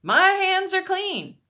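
high, loud, stretched-out proclamation; yelling. A narrator's reading. Pilate washing his hands before everyone, yelling. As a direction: proclaim it slowly at a high yell. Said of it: "Pilate yelled".

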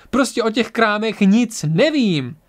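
high, slow exasperated outburst; nervous. A narrator's reading. Doctor admits ignorance of the attacking objects, nervous. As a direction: stretch the words out in rattled frustration. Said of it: "řekl nervózní doktor".

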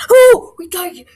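loud, high cry of joy; excited shout. Real, not acted. Gamer pulling a diamond card, shouting with joy. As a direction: burst out at the lucky pull.

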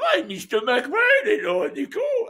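high mock French manager voice; French accent, high and slow. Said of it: "just in case you didn't recognise the accent".

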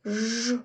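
"ж" alone as a long buzz; hard consonant sound. Shown as a demonstration. Teacher gives children the first sound, hard buzzed "ж". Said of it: "Твердий приголосний".

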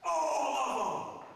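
slow, loud, emphatic cry; emphatic shout. Real, not acted. Preacher shouting to the congregation, emphatic. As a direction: hammer every word home. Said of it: "I'm gonna be emphatic".

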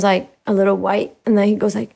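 ordinary talk, nothing marked

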